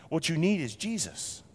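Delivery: ordinary delivery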